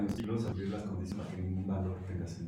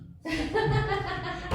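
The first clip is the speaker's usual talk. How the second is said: high laugh; 5 dB louder than usual. Real, not acted.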